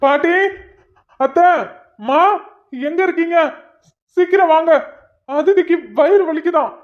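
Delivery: high-pitched, panicked calls through the house; urgent calling out. Acted. Husband calls family to his wife in pain, urgent calling out. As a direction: summon help in alarm.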